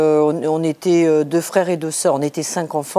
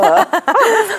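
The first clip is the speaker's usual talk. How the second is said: high squeaky laugh; 14 semitones higher, 4 dB louder than usual. Real, not acted. Laughing after ogre demo.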